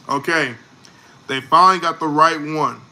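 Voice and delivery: ordinary delivery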